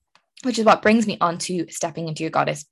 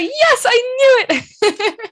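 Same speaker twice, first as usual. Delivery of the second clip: laughing triumph; 6 dB louder, much slower than usual. Retold or imitated. Test result arrives in time.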